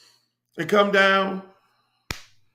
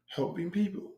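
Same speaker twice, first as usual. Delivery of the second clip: quiet catchphrase; 9 dB quieter than usual. Pretend. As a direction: toss the tagline off quietly. Catchphrase repeated low.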